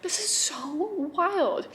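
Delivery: very slow, stretched amazement; awed, drawn out. Retold or imitated. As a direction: let each word hang in disbelief.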